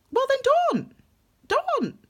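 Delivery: high-pitched, dismissive retort; exasperated retort. Acted. Reader answering people who say you can't, exasperated. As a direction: throw it back at them, pitched right up.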